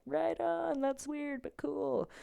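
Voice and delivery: high, soft schoolkid voice; high, softened imitation; mock stoner-like approval. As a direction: imitate a kid approving in falsetto.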